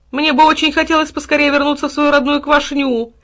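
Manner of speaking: high, loud sustained whine; tearful. A narrator's reading. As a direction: wail plaintively for home. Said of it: "обливался горючими слезами хлеб". (плаксивое нытьё)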